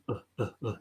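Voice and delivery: very soft "Uh, uh, uh", mouth barely open; quiet repeated "Uh" with mouth neither open nor closed; neutral schwa. Shown as a demonstration. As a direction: say "Uh, uh, uh" as quiet, relaxed mid-central vowels. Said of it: "You're not really opening your mouth".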